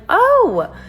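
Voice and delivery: loud, high-pitched 'oh'; shocked realization. Retold or imitated. Learning what kind of star he is, shocked. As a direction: voice leaps up in retold shock. Retold shock at the search result.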